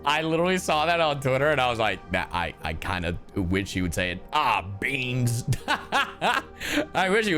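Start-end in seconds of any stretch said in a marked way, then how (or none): none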